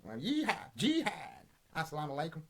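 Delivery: hammed-up American twang, leaping high on the whoops; exaggerated American sportscaster send-up; mock American commentary. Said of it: "what's this American commentary about".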